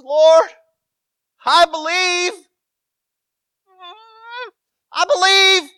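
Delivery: high, loud tearful cry to the Lord; crying plea. Acted. Desperate father crying out to Jesus, high and loud. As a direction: wail it out through tears, slowly. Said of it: "he says through tears as he cries".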